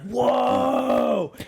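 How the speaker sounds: high shouted amazement; amazed whoa. Retold or imitated. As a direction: gasp it like a thrilled kid. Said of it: "we were like".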